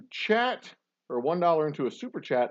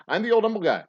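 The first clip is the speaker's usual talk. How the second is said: higher, louder host persona; showman intro; 10 semitones higher, 6 dB louder than usual. Acted. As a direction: announce yourself like a showman.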